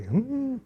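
a high, soft comic hmm; high mock hum. Joke meditation hum.

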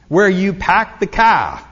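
put-on playful Boston accent, dropped r, slow; mock Boston drawl, car said cah. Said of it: "you're from Boston".

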